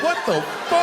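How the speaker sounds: high shocked blurt. Retold or imitated. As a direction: caught off guard. Shocked at wife's phone trick.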